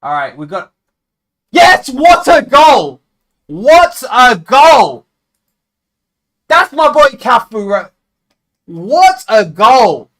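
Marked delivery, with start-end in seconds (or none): 1.5-10.1 loud, high, slow roar of joy; goal celebration. Real, not acted. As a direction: scream it like your team just scored.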